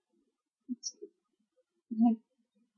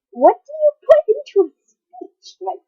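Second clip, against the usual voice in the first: high, loud exasperated question; 11 semitones higher, 6 dB louder than usual. Real, not acted. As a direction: throw it out in baffled frustration. Upset rant about speech assignment.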